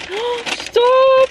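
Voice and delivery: high excited shriek; excited squeal. Real, not acted. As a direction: squeal with delight. Excited squeal at cute box.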